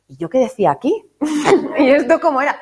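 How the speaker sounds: giggling through the puzzled questions; laughing self-quote. Retold or imitated. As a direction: laugh while asking yourself what it meant. Laughing at her own confusion.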